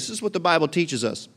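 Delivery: ordinary delivery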